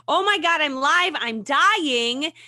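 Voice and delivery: loud, high frantic mock yell; toddler-voiced panic, hammed up; mock panicked cry. A mother imitating her toddler's loud panicked cry. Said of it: "But why is he saying".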